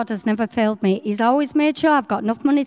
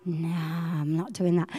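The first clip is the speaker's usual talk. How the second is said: hushed, fearful nah, much softer; scared refusal; 7 semitones lower, 8 dB quieter, slower than usual. Retold or imitated. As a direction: shrink back and refuse quietly. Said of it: "'cause I got scared".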